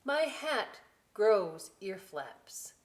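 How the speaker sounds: put-on slow, lively read-aloud to children; storybook voice, slow and bright; story reading. A narrator's reading. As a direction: savour each line for the kids. Reading book.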